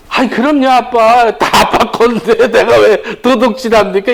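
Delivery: cheerful believer voicing a child with a laugh; child talking to dad. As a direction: play a happy kid answering his dad. Believer speaking fondly to God.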